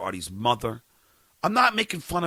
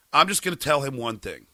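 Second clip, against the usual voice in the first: ordinary delivery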